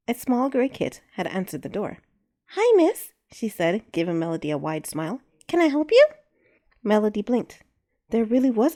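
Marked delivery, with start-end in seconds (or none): 2.5-3.1 put-on high, bright child voice, louder; a small girl answering the door politely; little girl voice. A narrator's reading. Small kit girl speaks. 5.5-6.1 put-on high, bright child voice, louder; a small girl answering the door politely; little girl voice. A narrator's reading. Small kit girl speaks.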